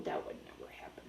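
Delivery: soft breathy whisper, fast. Real, not acted. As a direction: whisper it quickly to the dog. Whispered aside to the dog.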